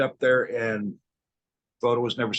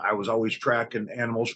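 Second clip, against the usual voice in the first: normal talking voice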